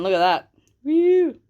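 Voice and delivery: normal talking voice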